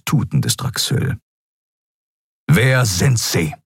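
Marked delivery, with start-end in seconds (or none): 2.5-3.6 slow, each word hit like an axe; every word stressed sharply. Acted. Admiral to prisoner, every word slow and sharp. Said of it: "betonte jedes einzelne Wort mit der Schärfe eines Henkerbeils".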